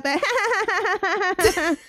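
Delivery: high, drawn-out mock laugh; exaggerated high fake cackle. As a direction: imitate a put-on British laugh. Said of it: "they do that whole fake laugh".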